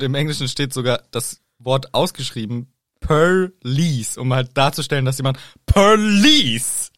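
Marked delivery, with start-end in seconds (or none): 3.0-4.2 "Perlease" drawn out in two exaggerated syllables; exaggerated please. Shown as a demonstration. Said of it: "so richtig übertrieben formuliert". 5.7-6.9 "Perlease" stretched long, high and loud; wildly exaggerated please. Shown as a demonstration. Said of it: "also so richtig übertrieben formuliert das".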